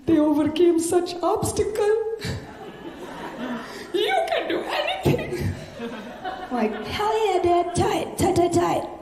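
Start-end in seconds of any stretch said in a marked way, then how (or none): 0.1-5.5 put-on high, choked-up dad voice with an accent; dad moved to tears by the movie; emotional Indian dad. Dad voiced while watching the movie. 6.9-8.9 cheering dad on during the movie; pumped-up cheer. Retold or imitated. Hyping up dad.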